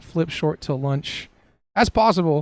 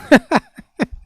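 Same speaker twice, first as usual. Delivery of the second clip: burst of laughter; 9 semitones higher, 10 dB louder than usual. Real, not acted. Bursts out at a funny comment.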